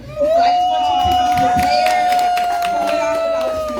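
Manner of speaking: high excited shout. Real, not acted. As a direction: call it out high and loud.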